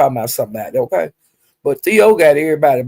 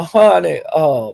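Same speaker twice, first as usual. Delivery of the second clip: ordinary delivery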